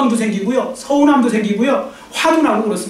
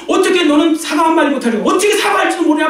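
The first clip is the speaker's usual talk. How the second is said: raised, accusing voice of grieving families; angry rebuke; 6 semitones higher than usual. Retold or imitated. Grieving families berate the killer, raised angry voice. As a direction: scold him high and sharp.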